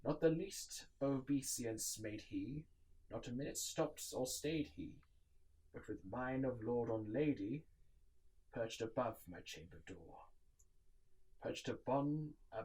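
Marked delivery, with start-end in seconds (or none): none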